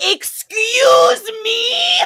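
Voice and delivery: drawn-out, high-pitched outraged shriek; over-the-top indignant scream; mock freak-out. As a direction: screech it slowly in hammed-up disbelief. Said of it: "He's, like, freaking out".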